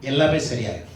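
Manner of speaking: soft, tender encouragement to a troubled father; gentle reassurance. Acted. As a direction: coax him kindly to believe. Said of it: "எங்க எப்படி சொல்றாரு". (மென்மையான ஆறுதல் குரல்)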